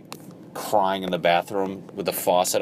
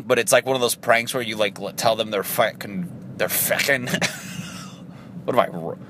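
ordinary talk

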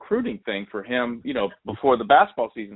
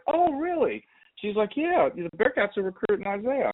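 high, bright mom voice; imitating the mom; 11 semitones higher than usual. Teller voices the excited mom, pitched high and bright. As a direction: lift the voice up into the mom.

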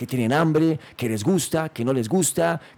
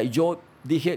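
normal talking voice

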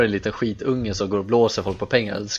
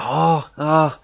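flustered scammer; 4 semitones higher, 4 dB louder, much slower than usual. Retold or imitated. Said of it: "Så han bara".

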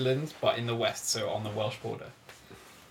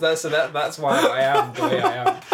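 laughing while talking; 6 semitones higher, 9 dB louder than usual. Real, not acted. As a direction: laugh through the words. Laughing at being dropped joke.

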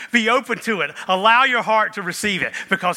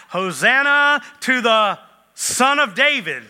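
higher and louder, a retold cry of "Hosanna"; mild crowd shout; 6 semitones higher, 5 dB louder than usual. Pastor voicing the Jerusalem crowd, mild mock shout. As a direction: call it out like the crowd. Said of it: "the crowd is yelling out".

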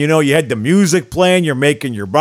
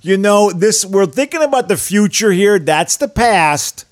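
put-on higher, louder Chicago boss accent, slowing at the end; mock Chicago accent; 7 semitones higher, 5 dB louder, slower than usual. Owner dismissing the past in Chicago accent. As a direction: wave the past away like a Chicago exec. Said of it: "do a Chicago accent of Rocky Wirtz".

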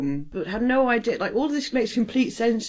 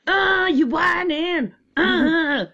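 mock whining; 7 semitones higher, much slower than usual. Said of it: "And it's like".

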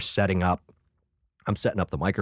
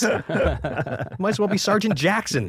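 much higher and louder, cocky and laughing; mocking sass; 12 semitones higher, 3 dB louder than usual. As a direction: talk down to him sassily. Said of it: "I'm like".

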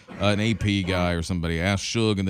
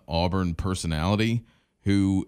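ordinary talk

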